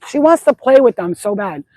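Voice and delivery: usual speaking voice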